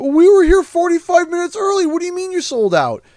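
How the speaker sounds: high, loud whine of an annoyed customer; mock the late crowd's high, loud complaint; whiny complaining customer. Latecomers griping to the store manager, high loud mock whine. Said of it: "some people were complaining".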